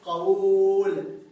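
قول stretched loudly into qawuuul; original form demonstrated. Shown as a demonstration. Teacher giving the original form to write down, قول loudly stretched as qawuuul. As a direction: draw out a loud long u in قول, qa-WUUUL. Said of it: "كتب عليه حتى أصله".

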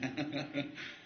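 a short quiet laugh under the breath; soft chuckle. Real, not acted. Teacher chuckling to his students at his own phrase, soft laugh. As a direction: chuckle softly at your own wording.

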